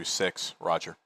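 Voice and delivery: ordinary talk, nothing marked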